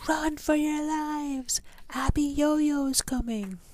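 high, slow alarmed cry of the townsfolk; panicked townsfolk. Retold or imitated. Townsfolk warning everyone, a high alarmed cry. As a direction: raise the alarm, high and drawn out. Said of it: "Women screamed and strong men fainted".

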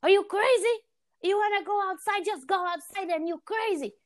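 high-pitched imitation of an agitated mom; freaking-out mom. Mom scolding daughter for wanting out, high mock agitation. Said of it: "she doesn't calm me down".

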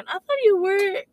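a hint of the surprised guide, drawn out; mildly imitated guide in English; quoting the guide. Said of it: "dia bilang gitu".

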